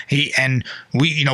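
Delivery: normal talking voice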